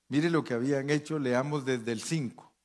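ordinary delivery

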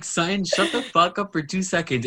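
high, exasperated retort; mock telling-off. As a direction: act out shutting someone up, pitched high. Acted telling-off of Sutton.

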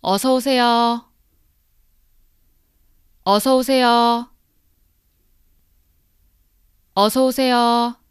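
"어서 오세요" dicho claro y alto, tres veces; dictionary pronunciation. Shown as a demonstration. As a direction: repite "어서 오세요" como modelo de clase. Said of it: "I'm gonna repeat it three times. Listen carefully".

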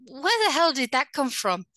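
put-on a light touch of an Irish accent, half-joking; mock Irish accent. Host imitating her own angry Irish slip, light Irish accent. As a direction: toss it off in a hint of Irish brogue. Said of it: "this Irish accent will come out".

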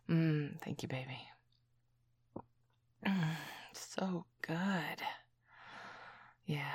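slow intimate whisper. Acted. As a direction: savor it in a whisper. Sipping wine together.

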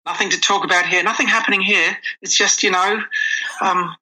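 a playful put-on northern English twang; mock northern accent. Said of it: "trying to channel that northern accent again".

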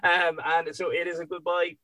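choking through laughter; words catching in the throat. Real, not acted. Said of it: "Sorry, I'm choking here".

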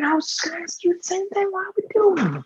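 hushed conspiratorial whisper. Acted. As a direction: lean in and whisper.

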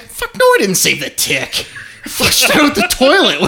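loud, high, incredulous retort; mock outraged protest. Patient answers doctor about the saved tick, mock outrage. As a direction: protest loudly, half laughing. Acted reply to doctor's question.

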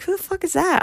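a high, outraged double take; baffled who is that. Retold or imitated. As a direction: shoot up in total confusion. Baffled by unknown rapper name.

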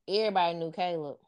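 ordinary delivery

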